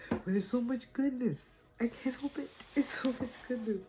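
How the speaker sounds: high-pitched, bubbling over with delight; gushing excitement. Real, not acted. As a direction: gush it, can't hold it in. Gushing, unable to slow down.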